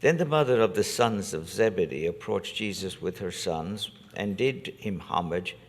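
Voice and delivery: usual speaking voice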